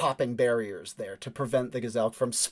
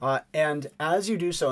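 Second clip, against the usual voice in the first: ordinary talk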